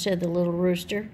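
usual speaking voice

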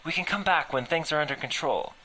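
loud, high, frantic protest; desperate loud interruption; cutting in. Acted. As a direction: cut her off in alarm. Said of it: "I interrupted".

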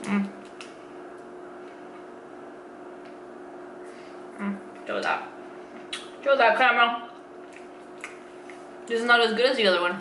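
talking between bites; chewing while talking. Real, not acted. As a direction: mouth partly full. Seen 3: Bit bar at -7; chewing visible 3.2–5.2, mouth hidden earlier.

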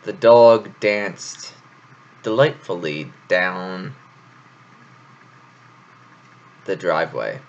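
dog danced delightfully down drawn out, slow and deliberate; alliteration demo. Shown as a demonstration. Showing off alliteration to listeners, slow and deliberate. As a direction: lean on each d sound slowly. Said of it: "I love alliteration".

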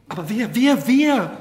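loud, high, mounting impatience; urgent demand. A narrator's reading. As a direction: demand the name, climbing on each word. Marquise desperate to learn the name.

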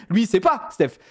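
normal talking voice

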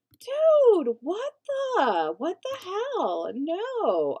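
high, loud disbelief; indignant, drawn-out outburst; exasperated. Real, not acted. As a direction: cry out in protest at the offender. Protests at the visitor's mess.

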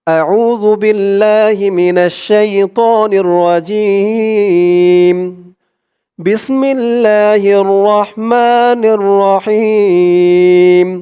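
slow, drawn-out tajweed chant; Quran recitation. Shown as a demonstration. Teacher opening the lesson with a slow Quran chant. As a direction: recite the verses slowly and melodically.

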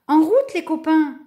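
high, loud rallying call; impatient. A narrator's reading. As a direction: urge the friends on impatiently. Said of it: "répétait-il impatient".

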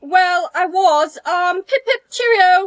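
loud, hammed-up British accent. Student covers for her missing accent, hammed-up British. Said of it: "speaking in a British accent".

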